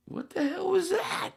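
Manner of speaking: shocked what. Retold or imitated. Reliving the shock.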